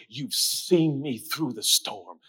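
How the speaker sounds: hushed, unhurried divine command; calm voice of God. Acted. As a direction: speak gently, as if stilling the sea. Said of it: "God said".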